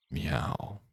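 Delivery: soft, low little meow; cat meow. Shown as a demonstration. Streamer shows off his best cat impression, a soft meow. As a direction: mew quietly like a house cat. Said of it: "I'm going to do my best cat impression".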